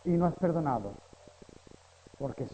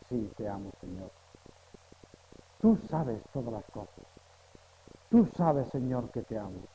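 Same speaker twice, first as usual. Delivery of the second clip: softly sincere; 6 semitones lower, 3 dB quieter than usual. Acted. Answering the Lord in prayer.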